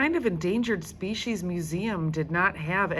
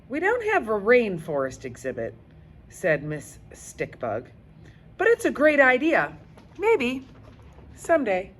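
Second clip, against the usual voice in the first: high, loud, fast cheerful guide voice; upbeat guide; 6 semitones higher, 5 dB louder than usual. Acted. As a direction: brush it off brightly. Guide answers Judy.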